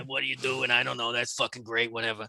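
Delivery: low, bored, husky woman; gravelly smoker's voice. Retold or imitated. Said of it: "that gravelly smoker's voice".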